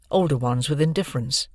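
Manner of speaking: ordinary delivery, nothing marked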